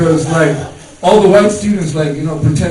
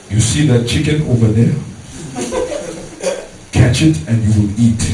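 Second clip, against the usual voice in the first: a lower, stern African father; stern dad voice; 5 semitones lower, 3 dB quieter than usual. Retold or imitated. Dad telling his hungry son to catch a chicken, stern low voice. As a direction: lay down the rule like a stern father. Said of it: "He's like".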